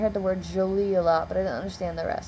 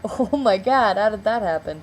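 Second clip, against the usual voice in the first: startled reaction; 2 semitones higher, 6 dB louder than usual. Retold or imitated. Acts her startled reaction.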